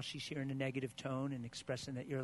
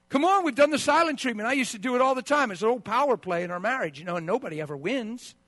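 loud, high-pitched pulpit fervor; fiery preaching; 8 semitones higher, 12 dB louder than usual. Real, not acted. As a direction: preach it hard to the congregation. Preaching at full force.

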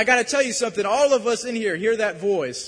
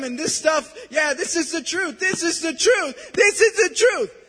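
raised voice climbing to a high shout; anguished, building outburst; 5 semitones higher, 4 dB louder than usual. Acted. Preacher acting out a man battered by lies, anguish rising to shouting. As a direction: build from urgent distress to full-force crying out.

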